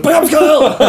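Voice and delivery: high laughing shout; imitating a shout. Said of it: "all of a sudden you just, like, bowl it".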